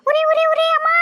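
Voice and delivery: high, loud pained wail; shrill cries of burning pain; crying out in pain. Acted. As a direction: yelp like your hand just got scalded. Burned hand, crying out.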